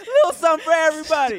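laughing. Real, not acted. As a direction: laugh through the punchline. Laughing joke.

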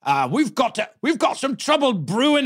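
a stage Cockney Alfred Doolittle greeting his daughter; Cockney accent. Pretend. Old man greeting his daughter Liza in a Cockney accent. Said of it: "Let's hear the Cockney accent".

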